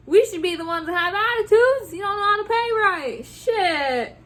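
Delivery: high, loud, sassy rant; indignant telling-off. Pretend. Worker telling off a late-paying customer in absentia, indignant rant. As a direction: let rip as if the offender were here.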